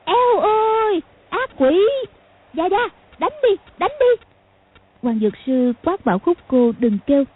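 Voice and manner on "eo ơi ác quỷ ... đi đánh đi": very high-pitched frightened wail; terrified shrieking. A narrator's reading. Khúc Cô in the dark begs grandpa to strike, terrified shriek. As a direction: shriek high for help against a demon. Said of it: "cứ kêu rú lên".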